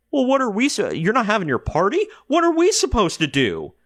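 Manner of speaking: high-pitched imitation of put-out friends; mock indignant complaint. Friends protesting to the hostess about the party, high mock indignation.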